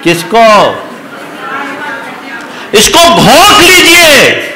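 high, loud emphatic sermon; full-force preaching. Acted. Preacher urging the audience to absorb the mantra, loud and high. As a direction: drive the mantra home to the crowd.

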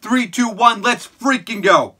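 high, loud countdown building to a stretched go; hyped countdown. Real, not acted. Vlogger counting viewers into the trailer, hyped and loud. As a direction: count down like launching a rocket.

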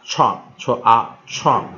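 "创" blended with an open "啊"; corrected vowel demo. Shown as a demonstration. Teacher settles on the ah sound, blending demo. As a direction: sound out "创啊" slowly, then join it. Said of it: "应该是啊".

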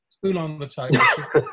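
laughing through the quoted order; laughing mimicry. Teller mocks the publisher again, laughing. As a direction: retell the line as the punchline, laughing. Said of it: "we say".

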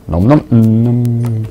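slow munching nom nom; playful eating sounds. Pretend. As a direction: savour it, nom slowly. Mampft Gegenstände im Spiel auf. (genüsslich mampfend)

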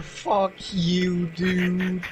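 ordinary delivery

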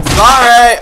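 high, loud "oops" apology; yelled apology. Real, not acted. Player to the person just hit, yelled apology. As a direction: blurt it out loudly. Calls out after hitting a person.

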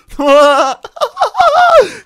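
high squealing laugh; high giggle. Real, not acted. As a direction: laugh hard at the joke. Laughing after teasing.

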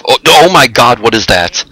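loud startled oh my God; sudden horrified outburst; shocked. Real, not acted. Sees burning body.

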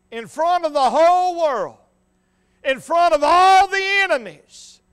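loud, rising preacher's cadence; full-force preaching. Real, not acted. Preacher sets the scene of the mocking crowd, full-force preaching. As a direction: build it line by line for the congregation.

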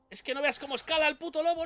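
fast, high-pitched exclamation; real agitation, quick and high; agitated alarm. Real, not acted. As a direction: rush it out in rising alarm. Alarmed at climbing wolf.

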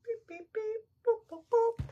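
high robotic beep boop; beeping noises. Pretend. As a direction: chirp like a little machine. Imitating beeping sounds.

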